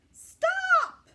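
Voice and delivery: high, loud yell. Acted. Parents stopping Ada's messy experiment, sharp loud yell. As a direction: shout to halt her at once. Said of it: "her parents yelled".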